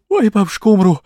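high, loud pained squeal. A narrator's reading. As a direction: cry out that she'll die. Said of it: "девка взвизгнула".

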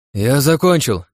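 put-on loud call to the girls; shout. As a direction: call out it's over. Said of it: "крикнул я девушкам". (громкий крик)

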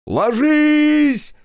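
very high, loud warning scream; thin, breaking yell. A narrator's reading. Zotov warning the trench of falling bombs, thin panicked yell. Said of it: "успел заорать Зотов тонким, срывающимся голосом".

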